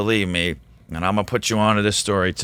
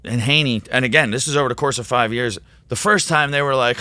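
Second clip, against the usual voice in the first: ordinary talk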